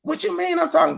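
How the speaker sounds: very high, mock-indignant comeback; imitating riled wife. Wife going in on her husband, high mock indignation. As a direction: jump way up in pitch for her retort. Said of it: "She might have went in on me".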